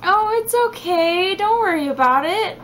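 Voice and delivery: lightly voiced soothing fiance. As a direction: imitate him comforting her. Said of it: "He was like".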